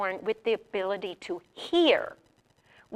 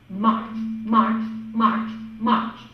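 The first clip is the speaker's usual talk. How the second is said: loud, evenly spaced chant; teaching a word; 5 dB louder, much slower than usual. Shown as a demonstration. Teacher drilling a drum on one word, loud and rhythmic. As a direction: call it out firmly on a steady beat. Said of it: "I'm going to teach a word".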